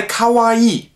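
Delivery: Kawaii with a long, drawn-out I, slowly; correct Japanese pronunciation. Shown as a demonstration. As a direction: stretch the I in Kawaii. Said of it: "Kawaii ist die richtige Aussprache". (Kawaii langsam mit langem I)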